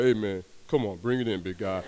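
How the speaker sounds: low, put-on guy voice; big hugger voice. Chef calls the friend in for a hug, imitated low voice. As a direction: drop the voice low for an imitated bear-hug invitation.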